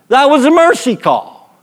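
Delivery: high, defiant retort; mock yelling. Pitcher answers back to the umpire, mock-angry defiance. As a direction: hurl it back, acting out the argument. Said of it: "we're yelling".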